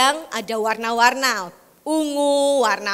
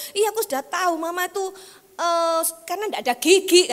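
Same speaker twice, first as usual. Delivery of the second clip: higher, mock dismissive; mocking the son; 6 semitones higher than usual. Preacher mimicking the son to him, higher. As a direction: parody the brush-off.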